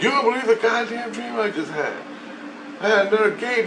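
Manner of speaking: high-pitched retold outburst; acted exclamation. Man telling his wife about a dream, exclaiming. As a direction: exclaim it high, as if reporting something unbelievable.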